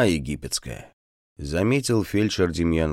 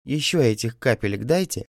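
sweet coaxing ask, higher voice; wheedling plea; 7 semitones higher than usual. Acted. Peasant woman sweetly begs the doctor for more drops. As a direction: coax him tenderly for more. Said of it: "умильно попросила баба".

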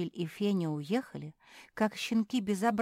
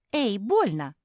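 louder, higher indignant yelp; 5 semitones higher, 6 dB louder, slower than usual. A narrator's reading. Жози protests to the sister who pinched her, indignant. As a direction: protest sharply at being hurt. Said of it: "возмутилась Жози".

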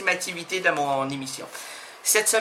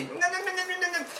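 quieter engine-like non non; motor imitation; 8 semitones higher, 9 dB quieter, faster than usual. Guest imitating an old car motor for the host. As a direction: hum the no's like an idling old engine. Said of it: "mais restez".

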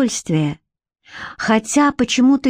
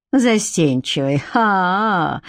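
mocking echo; slower than usual. A narrator's reading. Lover answering her claim of shyness, amused mockery.

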